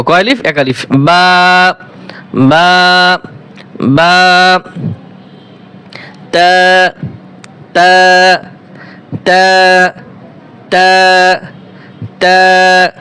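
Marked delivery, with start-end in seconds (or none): 0.9-4.6 বা held steady for one alif length; one alif stretch. Shown as a demonstration. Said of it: "এক আলিফ টান দেবো". 6.3-12.9 তা held steady and even, recitation style; one alif stretch. Shown as a demonstration. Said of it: "মাখরাজ হচ্ছে এগারো নম্বর মাখরাজ".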